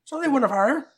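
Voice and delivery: high, cocky, egging the group on; high character voice. Acted.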